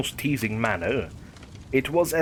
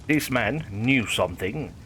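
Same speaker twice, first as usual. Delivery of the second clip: ordinary delivery, nothing marked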